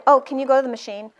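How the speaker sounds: ordinary talk, nothing marked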